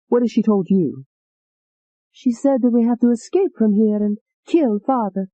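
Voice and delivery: a lightly voiced frightened young woman; pleading Alette.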